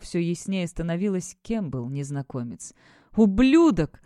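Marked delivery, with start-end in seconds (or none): 3.2-4.0 furious cry, louder through tears; angry shout. A narrator's reading. Captive woman curses her torturer in a loud furious cry. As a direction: spit out the insult at the captor. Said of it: "выкрикнула зло Кора, глотая слёзы".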